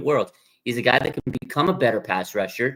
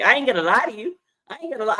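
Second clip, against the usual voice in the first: much higher, playful imitation of the player; playful higher mimic of the catchphrase; mimicking his catchphrase; 11 semitones higher, faster than usual. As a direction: echo his signature line, amused. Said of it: "that's his saying".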